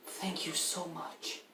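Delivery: soft, slow, drawn-out thanks; grateful. Acted. Rescued mother thanking the party, soft and grateful. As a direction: thank them softly and slowly.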